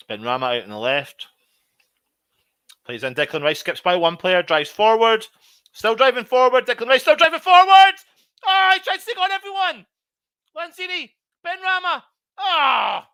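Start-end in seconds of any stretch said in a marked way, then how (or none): 2.9-9.9 rising to a frantic shout as he drives forward; play-by-play building to a roar; excited commentary. Real, not acted. Excited live commentary on a run. 10.6-13.1 high, strained calling of names; names yelled, then a cry; frantic high shouting. Real, not acted. Commentating a frantic attack.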